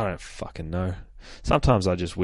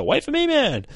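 eager call; 3 dB louder than usual. Acted. Ruckus chasing after the dog.

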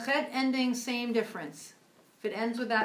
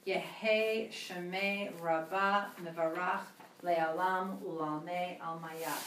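slow; 3 semitones lower, 3 dB quieter than usual. Shown as a demonstration. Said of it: "That was slow".

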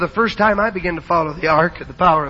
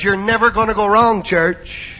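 usual speaking voice

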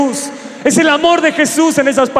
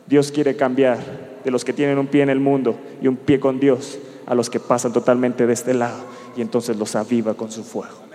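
softer, lower, searching questions to the congregation; quiet pastoral appeal; 9 semitones lower, 6 dB quieter than usual. Acted. Pastor quietly asking the youth about their spiritual state, soft and low. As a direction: drop down low and speak to each heart.

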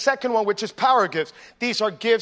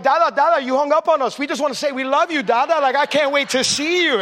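put-on higher, louder childlike pleading to dad; eager little kids; 5 semitones higher, 4 dB louder than usual. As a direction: play a small kid begging dad not to hang up. Kids call back, upset he hung up.

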